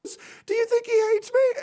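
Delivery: put-on very high, worried girl voice; Sophia. Insecure friend asking if he hates her, high voice. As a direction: imitate an anxious friend's high little voice. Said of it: "And I'm like".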